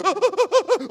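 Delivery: loud, high comic sobbing gasps; mock sobbing noises. Dad demonstrating his crying noises, loud mock sobs. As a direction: heave out exaggerated crying noises. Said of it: "when you're making the noises".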